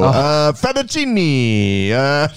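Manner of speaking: loud, hammed-up Italian accent with drawn-out vowels; big cheesy Italian lover; mock Italian. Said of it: "was talking Italian".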